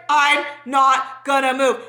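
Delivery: slow, frozen word-by-word delivery; acting paralyzed. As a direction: mimic being too stiff to move. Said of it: "I felt paralyzed".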